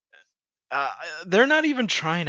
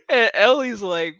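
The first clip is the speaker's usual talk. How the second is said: ordinary talk, nothing marked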